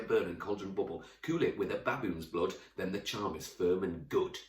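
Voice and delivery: rattled off fast and driving, rap-style; super fast. Shown as a demonstration. Teacher raps the witches' chant as fast as possible. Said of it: "read this Song of the Witches in a super fast way".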